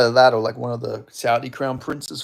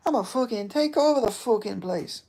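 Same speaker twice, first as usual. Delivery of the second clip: high, put-on cocky voice; mock boast; 9 semitones higher than usual. Streamer jokes to chat he'll replace Dana, in a cocky put-on voice. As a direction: play a cocky boss announcing a takeover.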